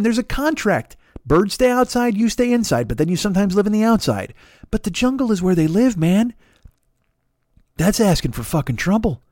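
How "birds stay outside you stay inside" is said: normal talking voice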